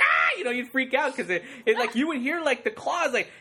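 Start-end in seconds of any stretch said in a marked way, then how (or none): 0.0-0.4 sudden high shriek of fright; kid's scream. Retold or imitated. Kid in the theater shrieking at the claws. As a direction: shriek like a startled kid at a 3D movie. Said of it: "I'd be like".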